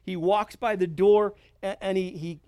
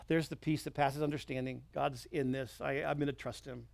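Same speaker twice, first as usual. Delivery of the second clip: sighing, much softer voice, settled and at peace; relieved calm; 5 semitones lower, 8 dB quieter than usual. Retold or imitated. Believer in church finding peace, softly relieved. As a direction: let the worry go out of you in a sigh.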